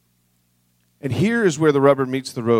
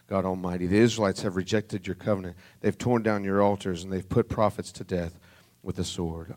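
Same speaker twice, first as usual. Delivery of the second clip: hushed reread; 6 semitones lower, 7 dB quieter than usual. A narrator's reading. Same complaint reread after gentle whisper.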